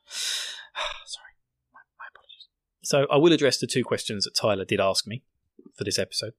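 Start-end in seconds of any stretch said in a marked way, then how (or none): 0.1-2.5 sheepish, breath-only whisper; whispered apology. Real, not acted. Host owning up to Tyler, sheepish whisper. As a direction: sigh and whisper it very quietly.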